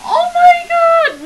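high, loud, drawn-out scream; shocked shout. Real, not acted. Streamer reacting to the game, shrieking high and loud.

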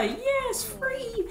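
high, small crowd voice; delighted villagers. Acted. Villagers reacting to a promised surprise, high and delighted.

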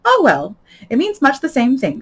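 high, loud Duchess voice; breezy Duchess. Acted. Duchess agreeing with Alice, breezily. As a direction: brush it aside in a high, emphatic voice.

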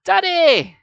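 very high, very loud scream of a name; shriek. A narrator's reading. Sarah sees her father in the case, shrieking. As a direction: shriek out for Dad in horror. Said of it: "Sarah shrieked".

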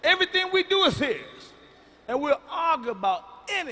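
loud, high mock-serious declaration; booming comic proclamation; shouted opener. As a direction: bellow it to the crowd. Comedian opening, shouted emphasis.

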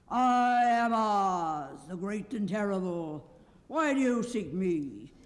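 slow, booming, loud voice from the throne; great and terrible Oz. A narrator's reading. The unseen Oz booming at the four travelers. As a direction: boom each word slowly and loudly. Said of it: "they heard a voice".

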